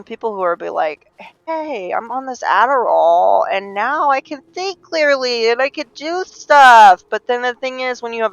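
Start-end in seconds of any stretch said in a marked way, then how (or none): 1.5-7.0 high, slow, drawn-out enthusiasm; exaggerated high cheery boasting; mock-upbeat quote. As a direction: imitate someone thrilled with themselves, building louder. Acted quote of Adderall user.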